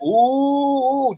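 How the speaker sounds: high, teasing schoolkid ooh; taunting ooh. Retold or imitated. Schoolkids on the bus taunting two boys, mocking high ooh. Kids egging on the fight.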